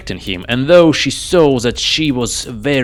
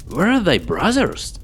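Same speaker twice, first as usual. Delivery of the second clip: higher, anxious father asking; worried king; 10 semitones higher than usual. Acted. King asking his daughter where her brothers went, anxious.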